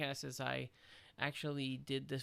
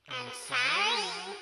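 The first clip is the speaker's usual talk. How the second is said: squeaky, very slow sorry; pitched-up voice changer; 10 dB louder, much slower than usual. Shown as a demonstration. Apologizing for the podcast in a voice-changer squeak. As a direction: stretch it out through a chipmunk filter. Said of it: "talk into this little voice changer".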